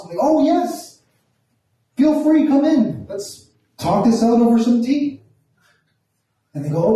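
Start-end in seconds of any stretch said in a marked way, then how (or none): 0.2-5.2 high, over-polite invitation; hammed-up hospitable politeness; effusive welcome. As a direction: play the gushing host, pitched way up. Acts out welcoming the cops in.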